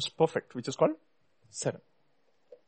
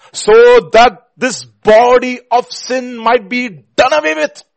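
slow, high, shouted proclamation; full-force preaching; 9 semitones higher, 14 dB louder, much slower than usual. Real, not acted. Preacher declaring sin done away with, full force. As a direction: climb to a shout on the last words.

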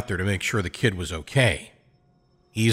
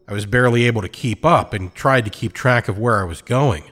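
ordinary delivery, nothing marked

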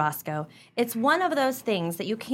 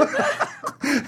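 short high amused laugh; 13 semitones higher than usual. Acted. Couple mocking neighbor's crafts.

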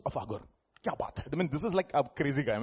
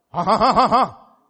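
laughing; 5 semitones higher, 11 dB louder, slower than usual. Real, not acted. Preacher laughs aloud.

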